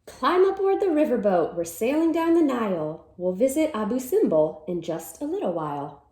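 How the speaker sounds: lively storybook read-aloud; reading to children. Acted. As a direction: read it like a cheerful picture-book chant. Reads picture book aloud.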